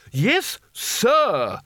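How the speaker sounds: high sharp snapped correction. Acted. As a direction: bite off the correction. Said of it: "snapped Spelter".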